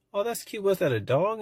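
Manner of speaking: put-on high, sing-song praise for a child; sugary teacher voice. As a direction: coo over the drawing like a sweet teacher.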